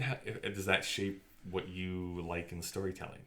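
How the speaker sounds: normal talking voice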